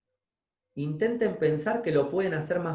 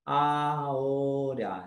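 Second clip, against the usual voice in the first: very slow, savoured; much slower than usual. Shown as a demonstration. Teacher resisting the urge to rush, savouring each word slowly. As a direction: chew each word of aquí y ahora very slowly. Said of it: "empiezo a modular lo que digo, a masticar".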